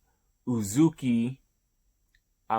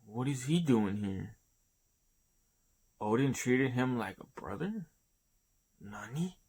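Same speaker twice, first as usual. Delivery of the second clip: confused; 6 dB quieter than usual. Retold or imitated. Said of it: "freaking, like, confused too".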